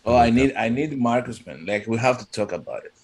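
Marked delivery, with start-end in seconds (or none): none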